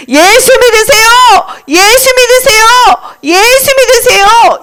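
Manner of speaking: high, repeated proclamation; insistent high call; evangelist's cry. Acted. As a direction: chant it high, over and over, to the crowd. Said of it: "이렇게 말했어".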